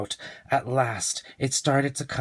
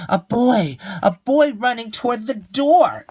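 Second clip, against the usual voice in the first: put-on much higher, louder child exclaiming; excited discovery; 11 semitones higher, 7 dB louder than usual. Leo spots the boy in the hat, excited. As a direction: burst out at what you see.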